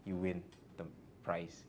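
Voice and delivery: ordinary talk, nothing marked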